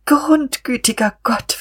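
high, drawn-out whimper of despair. A narrator's reading. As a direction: wail it weakly, pitched right up. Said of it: "wimmerte sie". (hohes, gedehntes Wimmern)

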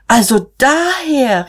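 high, drawn-out cry of realization; exclaiming. A narrator's reading. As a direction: call out as if it all makes sense. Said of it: "rief Magda". (gedehnter, hoher Ausruf)

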